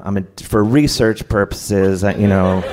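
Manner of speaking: ordinary delivery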